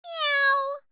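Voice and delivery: a cheerful cat's meow. Acted. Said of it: "I like the way you added meow".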